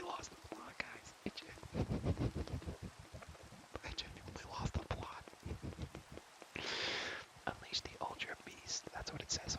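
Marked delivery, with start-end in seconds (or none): 1.8-3.8 breathy, voiceless giggle; whispered laugh. Real, not acted. Laughing at losing the plot, whispered laugh. 5.3-7.4 breathy, voiceless giggle; whispered laugh. Real, not acted. Laughing at losing the plot, whispered laugh.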